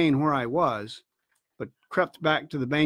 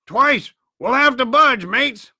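high, raised urging; urgent warning; 6 semitones higher, 4 dB louder, slower than usual. A narrator's reading. Crewman warns his mates of danger, urgent raised voice. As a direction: urge your mates to move.

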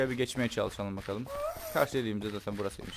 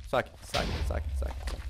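whispered calm. Real, not acted. Whispering to himself under fire to stay calm. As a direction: whisper it to yourself, steady.

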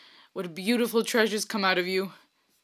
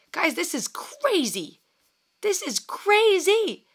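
amazed disbelief; 10 semitones higher, slower than usual. Real, not acted. Telling viewers how crazy the girl's singing is, slow amazed awe. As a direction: let the awe grow on the repeat.

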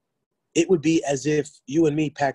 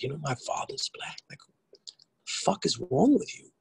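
furious whisper; 4 dB quieter than usual. Retold or imitated. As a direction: whisper it like you're barely holding back. Mom confronts racist relative, retold.

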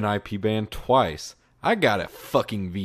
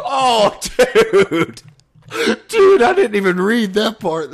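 laughing; 11 semitones higher, 11 dB louder than usual. Real, not acted. Cracking up at read message.

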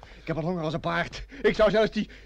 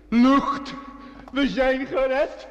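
high, louder cry of relief, the second line very slow; relieved outcry; 5 semitones higher, 4 dB louder, much slower than usual. Acted. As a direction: gasp it out as the air comes back. Trapped men reach open air. (opgeluchte uitroep, hoog en luid)

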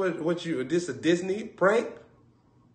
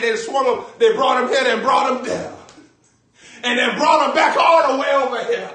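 louder, higher, excited narrating; excited retelling; 5 semitones higher, 5 dB louder than usual. Retold or imitated. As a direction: throw the story out loud. Retelling the throw, shouting.

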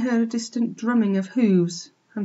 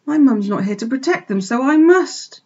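higher, louder piglet resolve; brave resolve; 6 semitones higher, 6 dB louder than usual. A narrator's reading. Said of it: "he said to himself bravely".

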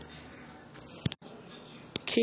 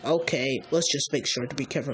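ordinary delivery, nothing marked

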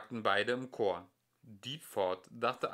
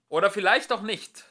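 loud retort; 9 semitones higher, 12 dB louder than usual. A narrator's reading. Philosopher wags finger shouting.

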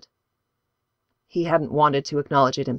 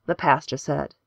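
ordinary delivery

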